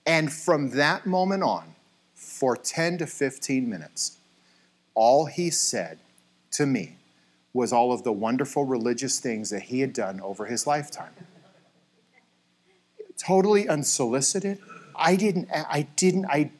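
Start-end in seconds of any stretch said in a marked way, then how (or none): none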